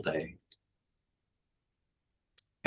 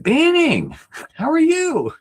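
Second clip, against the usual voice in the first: much higher voice playing his dad on the phone; voicing dad; 3 dB louder, slower than usual. Son imitates his dad answering the phone, high voice. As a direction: pitch way up to voice Dad.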